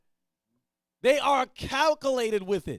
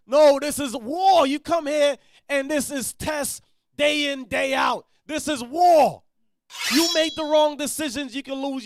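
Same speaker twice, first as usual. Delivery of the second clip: preaching; 2 semitones higher, 4 dB louder than usual. Real, not acted. Preaching at full force.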